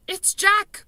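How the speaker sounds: loud, high terrified bellow. A narrator's reading. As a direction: bellow the name in panic. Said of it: "bellowed the flesh lump eater".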